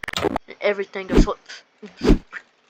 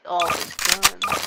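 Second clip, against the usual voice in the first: usual speaking voice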